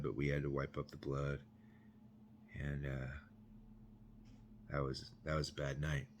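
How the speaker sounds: low, very soft, with long pauses; somber. Real, not acted. Former inmate recalling wiping up blood, somber and hushed. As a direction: let it trail into heavy, hushed remembrance. Somber end of traumatic memory.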